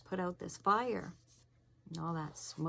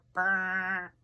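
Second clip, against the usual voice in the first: horn imitation; 6 dB louder than usual.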